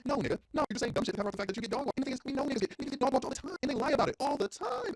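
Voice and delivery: loud, high-pitched exasperated outburst; heated rant. Real, not acted. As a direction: call them out, voice climbing high and loud. Heated retort to imagined men.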